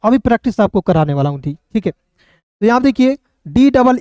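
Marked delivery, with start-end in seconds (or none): none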